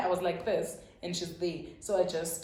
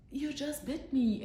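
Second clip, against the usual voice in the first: shocked; 8 semitones higher than usual. Retold or imitated. Shocked at being bitten.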